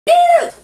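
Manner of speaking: high piercing "ew"; disgusted shriek. Shown as a demonstration. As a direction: squeal in disgust. Mimes reacting to a grab.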